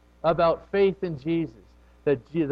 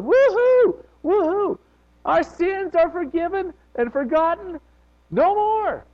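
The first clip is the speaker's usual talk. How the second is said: much higher and louder jubilant cry; rejoicing, exultant praise; joyful shout; 5 dB louder, slower than usual. Shown as a demonstration. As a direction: celebrate forgiveness at the top of your voice. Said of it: "That's what we should be doing".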